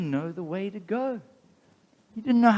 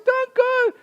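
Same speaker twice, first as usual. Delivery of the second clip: very high, light wife's voice; pleading wife; 7 dB louder than usual. Acted. Wife begging her husband to stay, voiced very high. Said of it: "His wife said".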